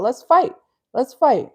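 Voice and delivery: acted burst of readiness to brawl; mock fighting challenge. Acts out someone snapping into fight mode.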